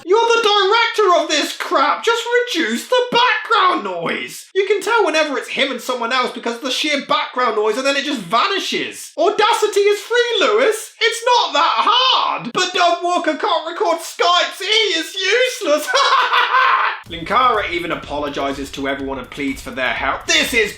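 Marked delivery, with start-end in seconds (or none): 0.0-15.9 a critic losing patience with the director; exasperated yelling. Real, not acted. Ranting at the director. 15.9-17.0 high, loud burst of laughter; a big laugh right after the outburst. Real, not acted.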